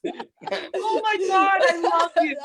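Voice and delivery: high, loud, laughing delight; cracking up at the punchline. Real, not acted. Host cracking up at a guest's twin story, laughing hard.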